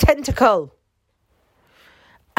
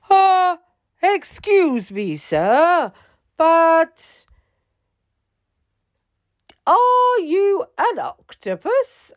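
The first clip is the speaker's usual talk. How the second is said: much higher, politely timid captain; 11 semitones higher, 4 dB louder, slower than usual. A narrator's reading. Captain politely asks the octopus about pirates, timid. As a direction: ask the huge octopus carefully.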